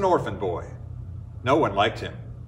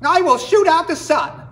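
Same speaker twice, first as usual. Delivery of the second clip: angry yell; 14 semitones higher, 7 dB louder than usual. Acted. Orphan wren yells at the people in fury. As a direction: scream the threat through angry tears. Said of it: "he yelled".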